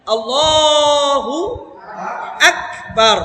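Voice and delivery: very slow, drawn-out recited phrase; Quranic recitation. Shown as a demonstration. Teacher gives students an example of the sound, recited slowly. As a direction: stretch it out in a measured chant.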